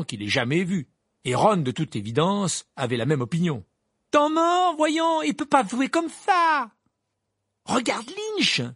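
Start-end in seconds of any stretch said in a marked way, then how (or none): 4.1-6.7 high, loud, drawn-out protest; indignant fan's outcry; outraged shout. Acted. Acted outcry over an injured player. 7.7-8.6 high, urgent exclamation; excited high-pitched call; crying out. A narrator's reading. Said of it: "s'écria Harry".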